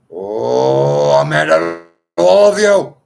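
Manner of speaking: loud strained cry; mad outburst. Real, not acted. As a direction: let the anger spill out over everyone. Outburst over music.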